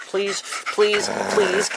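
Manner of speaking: high, repeated pleading. Real, not acted. Owner begging the dog to quit, high pleading.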